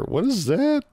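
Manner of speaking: sudden high, puzzled question; baffled, pitch jumping up; surprised. Real, not acted. Confused by odd radio call.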